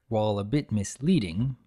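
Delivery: plain reading voice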